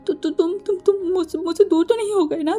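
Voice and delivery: cling to him, terrified he's gone; frightened pleading. Acted. Waking from nightmare, clinging. (डरकर हकलाते हुए गिड़गिड़ाना)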